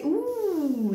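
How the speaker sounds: long high "ooh", sliding down; impressed "ooh". Real, not acted. Card reader seeing the Ace of Cups, long impressed ooh. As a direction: draw out an impressed, falling ooh. Reacts to card just drawn.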